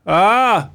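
mild imitated cry of distress; small mock scream. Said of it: "Antagligen har man börjat så här lite".

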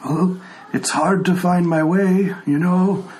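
put-on a frail old man who can't hear; old blind tiefling. Old tiefling NPC dialogue.

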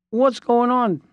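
mimicking an indignant old client; retold indignant outburst. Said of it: "Jack says".

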